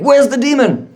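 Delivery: high-pitched acted alarm; storyteller's excited rescuer; urgent demand. Rescuer runs up, demanding the demon.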